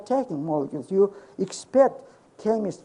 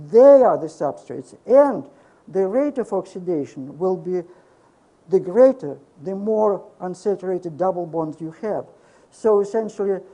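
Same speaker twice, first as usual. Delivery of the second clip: usual speaking voice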